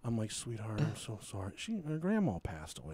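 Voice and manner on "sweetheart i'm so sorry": soft, low sympathetic voice; tender condolence. Retold or imitated. Teller consoling Kelsey about her grandma, soft and tender. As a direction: comfort her gently.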